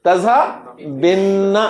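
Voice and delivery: تذہبن said very slowly with a long held نّ; teacher modelling تذہبن slowly, holding the final نّ; drawn-out doubled nun. Shown as a demonstration. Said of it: "کیا بن گیا؟".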